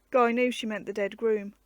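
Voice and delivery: ordinary delivery, nothing marked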